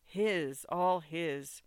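plain reading voice

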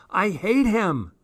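high, swooping wail of hate; escalated, melodramatic outburst; anguished loathing. A narrator's reading. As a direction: wail it, pitch leaping up. Diary outburst, escalating repeat.

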